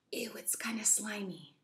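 whispered, disgusted; grossed-out whisper. Real, not acted. Feeling the slimy toy, grossed-out whisper. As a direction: whisper your disgust at the slime.

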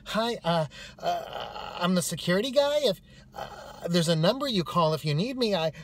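high, halting mock introduction; flustered security guy. Security guy given one minute to speak, flustered. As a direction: stumble through it with loud, hesitant bursts.

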